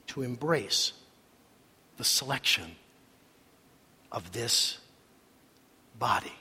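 low, soft, halting close; solemn appeal. Real, not acted. Solemn closing appeal to the congregation.

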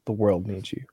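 low, soft, grave appeal; lightly acted official making a grave request; solemn president. President recruiting a civilian, quiet and solemn. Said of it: "she came in and she".

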